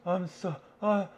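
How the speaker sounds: high, fast whiny self-mock; mock panic. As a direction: whine like a scared try-hard, pitched high. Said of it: "to go".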